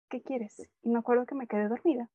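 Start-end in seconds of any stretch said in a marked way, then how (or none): 0.1-0.7 curt reply. Shown as a demonstration. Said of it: "esa fue mi expresión".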